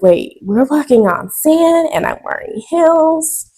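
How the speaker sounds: high, alarmed squeak of worry; mock-panicked wobble in high heels on sand; nervous protest. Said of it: "And so I'm like".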